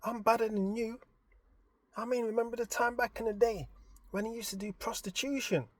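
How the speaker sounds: lightly voiced wife showing off; imitating his missus. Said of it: "my missus is like".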